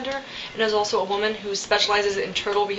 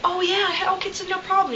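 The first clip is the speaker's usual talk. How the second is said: lightly acted helpful guy, pitched way up; helpful guy imitated; 10 semitones higher than usual. Bystander agreeing to help flip the turtle, imitated high-pitched helpful guy. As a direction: agree readily in a much higher voice. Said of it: "one guy's like".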